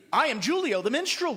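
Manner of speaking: a minstrel proclaiming himself; proud cry. Acted. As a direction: call out your name to the crowd. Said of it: "cried one".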